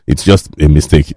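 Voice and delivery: usual speaking voice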